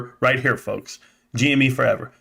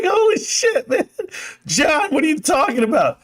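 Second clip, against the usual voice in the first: very high-pitched mock disbelief; hammed-up incredulous outburst, pitched way up; acted astonishment; 14 semitones higher than usual. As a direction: squeal it as a stunned retold reaction. Acting out stunned reply to friend.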